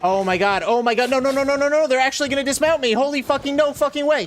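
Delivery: panicking as the chase goes wrong; panicked yelling. Real, not acted. Game character about to be dismounted.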